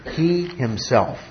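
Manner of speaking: "He himself" drawn out slowly, falling at the end; slow right down on "He himself" to single him out; deliberate, measured stress on "He himself"; emphatic "He himself". Shown as a demonstration. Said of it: "Emphatically him".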